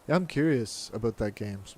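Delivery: ordinary delivery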